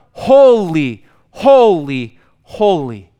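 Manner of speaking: loud then fading triple cry; seraphim shouting. Retold or imitated. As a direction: ring out the first holy, then let it fall away. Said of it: "pounding Isaiah's eardrum".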